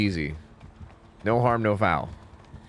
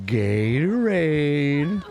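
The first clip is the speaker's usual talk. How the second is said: dumb movie voice; much slower than usual. Retold or imitated. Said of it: "Adam Sandler just did, like, a dumb voice".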